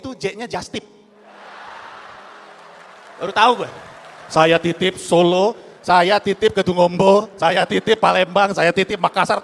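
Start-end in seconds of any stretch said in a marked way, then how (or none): 4.3-9.4 very slow, louder, rising ends; mock speech impression. Imitating a politician's farewell speech, slow and solemn. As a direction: intone each line like a solemn pledge. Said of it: "Lihat videonya nggak?".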